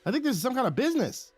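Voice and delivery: amused disbelief. A narrator's reading. Laughs at the spirit's question.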